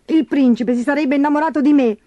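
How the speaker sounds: high, tearful-sounding put-on whine; self-pitying moan at normal pace; whimpering stepsister. A narrator's reading. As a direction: snivel like a spoilt crybaby. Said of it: "piagnucolò Gertrude".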